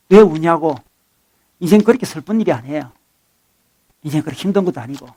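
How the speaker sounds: ordinary talk, nothing marked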